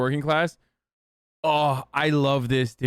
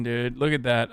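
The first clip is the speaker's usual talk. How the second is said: ordinary delivery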